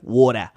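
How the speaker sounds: "Water" low, with a wide-open mouth; Australian accent. Shown as a demonstration. Showing how they say it, "Water" ending in a wide AH. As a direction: say "Water" Aussie-style, dropping the ER for an AH. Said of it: "gotta say it with a real big".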